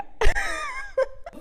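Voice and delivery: squealing burst of laughter; high laugh. Real, not acted. Laughs at own punchline.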